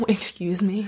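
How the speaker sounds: whispered through a laugh; laughing disbelief. Retold or imitated. Retelling Yolanta's reaction, laughing whisper. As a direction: giggle out a mock-offended question.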